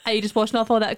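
ordinary delivery

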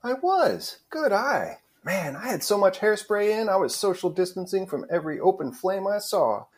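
very high, perky character voice; high-pitched, pleased storytelling between acquaintances; Melanie, chatty. Acted. Melanie's reply in read script.

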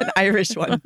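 playful attempt at an Irish accent; mock Irish accent. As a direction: put on a light Irish lilt. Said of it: "I'm gonna respond in an Irish one".

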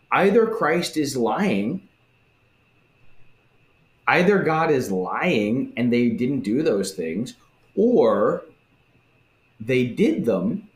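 usual speaking voice

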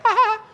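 high, loud "ha ha"; mock laugh. Preacher parodying believers with a system, high mock laugh. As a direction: crow a put-on triumphant laugh. Caps the mocking system quote.

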